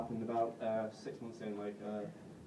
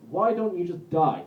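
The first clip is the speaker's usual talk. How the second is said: slow, louder deadpan put-down; blunt reply; 4 semitones higher, 8 dB louder, slower than usual. Retold or imitated. Friend replying to Sean's depression text, slow blunt put-down. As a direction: read the cruel text flatly and slowly. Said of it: "He texts back".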